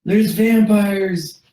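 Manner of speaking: high, loud and slow alarm; panicked yell. Acted. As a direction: shout the warning in terror. NPC yelling warning.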